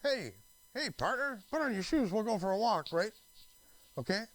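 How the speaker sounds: high, cheery dog voice; talking dog. Pretend. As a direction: play the dog inviting his partner out. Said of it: "It's a dog that says".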